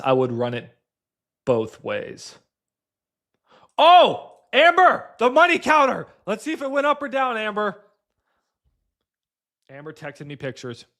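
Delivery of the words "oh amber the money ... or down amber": high, loud call across the room. Real, not acted. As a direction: call out to Amber. Calling out to Amber.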